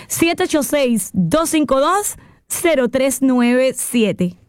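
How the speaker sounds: slow, number by number; slow dictation. Shown as a demonstration. Insurance host dictating her number, slow and deliberate. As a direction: read the phone number slowly so they can write it. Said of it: "Es el número a marcar".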